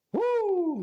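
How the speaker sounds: high, loud disbelieving whoop; a shocked yelp of disbelief; incredulous whoop. Real, not acted. Reacting to a dumb tweet.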